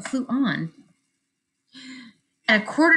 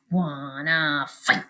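low, slow challenge; grouchy ladybug voice; 6 semitones lower, much slower than usual. Acted. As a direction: drag out wanna fight, menacing.

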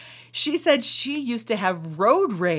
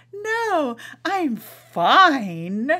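tight-lipped "fine"; 5 semitones higher, much slower than usual. Retold or imitated. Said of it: "using the F word".

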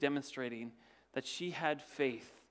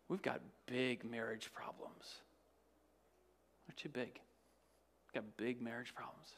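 soft whispered admission; a hint of hushed despair; whispered worry; 5 semitones lower, 12 dB quieter than usual. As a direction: confess it quietly. Voicing a burdened believer.

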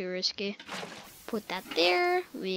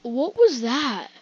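startled, voice cracking; 9 dB louder, slower than usual. Real, not acted. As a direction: let the voice crack on it. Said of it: "died doing a voice crack".

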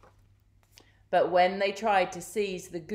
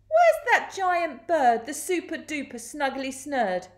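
high, raised demanding voice; impatient crook; 8 semitones higher, 4 dB louder than usual. A narrator's reading. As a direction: demand loudly as a frustrated villain.

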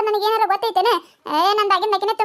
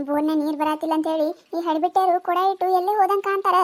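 ordinary talk, nothing marked

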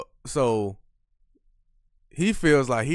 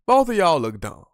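louder, higher, both of y'all hammered; emphatic verdict; 5 semitones higher, 6 dB louder than usual. Real, not acted. Host judging both sides of the deal, louder emphatic. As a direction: land the insult hard.

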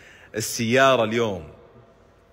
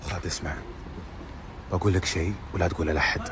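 leaning in to whisper; 9 semitones lower, 8 dB quieter, much faster than usual. Real, not acted. Telling a secret, don't tell anyone.

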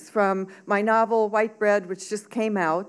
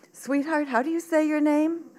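put-on higher, tender question to a child; gentle teacher; 7 semitones higher than usual. A narrator's reading. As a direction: ask a little pupil kindly, pitched up.

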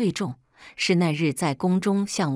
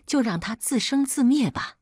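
higher-pitched, feebly offered way out; dry, awkward suggestion; 7 semitones higher than usual. A narrator's reading. Captive trying to spare her husband, dry and awkward. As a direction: offer it lamely, hoping to placate. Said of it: "我干巴巴地说着".

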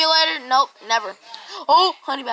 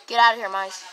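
ordinary delivery

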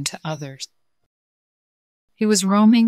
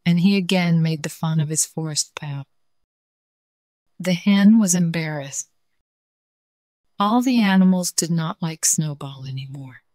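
ordinary delivery

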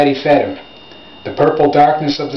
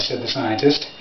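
ordinary delivery

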